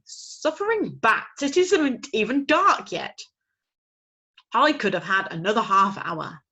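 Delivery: outraged at the early hour; grumbling father owl. Acted. Father owl complaining it isn't dark yet.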